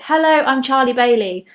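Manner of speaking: loud, bright opening line; confident greeting. Shown as a demonstration. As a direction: announce yourself to the room.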